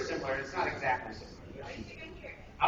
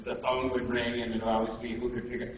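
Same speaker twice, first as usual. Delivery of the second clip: ordinary talk, nothing marked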